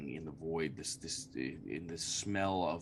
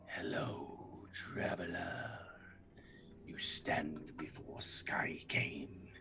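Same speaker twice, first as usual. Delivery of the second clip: eerie whispered spirit voice; whispering entity; 7 dB quieter, slower than usual. Acted. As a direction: whisper like an ancient dark power.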